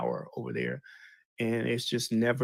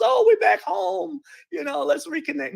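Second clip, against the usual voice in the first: mock the naive joyful return; mock happy homecoming; 6 dB louder than usual. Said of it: "not like they went over there with open arms".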